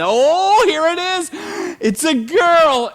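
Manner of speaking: high, loud delighted announcement; excited technician. Retold or imitated. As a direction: squeal out the news. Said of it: "and they".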